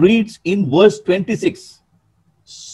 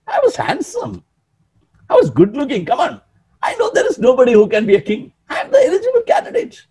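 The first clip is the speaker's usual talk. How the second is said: hammed-up bragging, leaping high in pitch; confident boast; 5 semitones higher, faster than usual. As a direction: brag like the obvious candidate, voice shooting up. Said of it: "You'd confidently look at this prophet".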